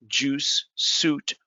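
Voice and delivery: ordinary delivery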